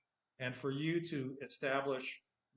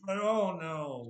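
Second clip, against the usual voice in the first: drawn-out, higher, louder "oh no"; mock dismay; 5 semitones higher, 7 dB louder, slower than usual. Teacher discovers his practice mistake, mock dismay. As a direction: play up the disaster, stretching it out.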